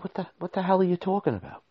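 can't believe what he hears; disbelief. Retold or imitated. Hearing the company left, fast high disbelief. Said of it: "I said".

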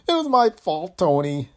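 high, loud, choked-up confession. A narrator's reading. Employee admitting fault to Tony, choked voice. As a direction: choke it out high, full of guilt. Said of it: "Greg said in a choked voice".

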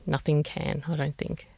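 ordinary delivery

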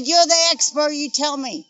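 high, loud, fed-up comeback to the investigator; indignant retort. Retold or imitated. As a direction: throw it back at her, indignant. Mother snaps back at investigator.

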